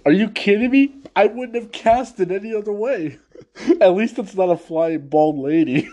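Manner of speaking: high, loud, gleeful jab; acting Toph. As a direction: rib them with delight. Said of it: "And then Toph".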